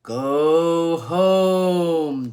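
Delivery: yowling wind. Acted. The wind yowls at Irene to turn back. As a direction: yowl it like a storm wind. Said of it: "the wind yowled".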